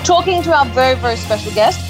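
ordinary talk

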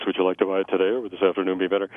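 fast, slick alternate-choice close; old-school sales line. Shown as a demonstration. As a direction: rattle it off like a fifties salesman. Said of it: "cute and clever in the old nineteen fifties alternate choice".

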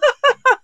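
high delighted laugh; high laughter. Real, not acted. Guest laughs along.

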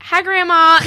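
high, loud country greeting with a laugh; country accent. Retold or imitated. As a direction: imitate yourself going full-out country on the phone. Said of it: "full out country accent up in here".

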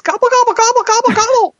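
very high squeaky turkey gobbles; gobbling turkey voice. Pretend. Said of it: "All I'd be saying is".

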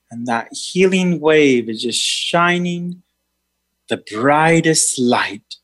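meditation guide voice; guided meditation. Acted. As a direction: lead a healing meditation. Leads a healing meditation.

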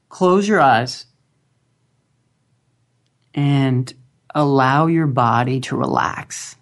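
slow, calm guided meditation voice; grounding exercise. Acted. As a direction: guide them gently into stillness, long pauses.

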